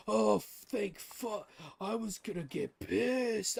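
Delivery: long, high mock sigh of relief; exaggerated relief. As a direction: imitate a relieved friend, stretching the words out. Imitates friend's relief after misunderstanding.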